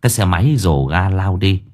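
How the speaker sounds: ordinary delivery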